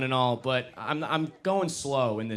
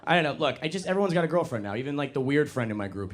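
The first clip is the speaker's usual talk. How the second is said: normal talking voice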